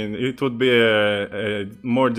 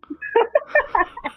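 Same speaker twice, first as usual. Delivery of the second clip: high squealing laugh. Real, not acted. Big laugh.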